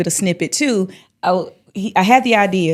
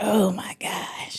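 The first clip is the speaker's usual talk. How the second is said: slow, drawn-out whisper; whispered dismay; 9 dB quieter, much slower than usual. Retold or imitated. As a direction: whisper it as a realization sinks in. Retelling a sudden dismayed realization.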